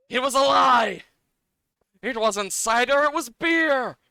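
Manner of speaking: hammed-up betrayed cry; mock outrage. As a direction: yell it like you've been tricked. Acted outburst over the cider trick.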